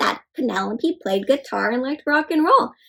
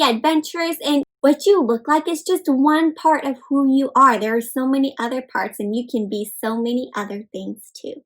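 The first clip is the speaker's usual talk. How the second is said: ordinary delivery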